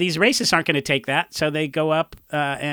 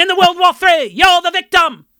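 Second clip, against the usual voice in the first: put-on loud, high English punk accent; 13 semitones higher, 8 dB louder than usual. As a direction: bark the lyrics in a snotty English punk accent. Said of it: "their first E P is one hundred percent English accent".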